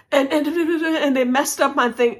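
high-pitched, stammering retold fluster; incoherent panic. Traveler explains her trouble to the airline man, flustered babble. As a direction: babble it as if too panicked to make sense. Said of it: "and I wasn't coherent".

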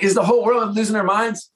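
high-pitched incredulous outburst; mock exasperation. Husband reacting to buddy's worry tree, hammed-up exasperation. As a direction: act out throwing up your hands in disbelief. Retold disbelief at friend's question.

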